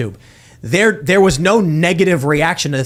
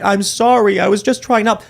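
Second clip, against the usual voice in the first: higher-pitched, pleading imitation; hammed-up apologetic voice; mock apology; 6 semitones higher than usual. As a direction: mimic someone saying sorry, pitched up. Mimicking a public apology.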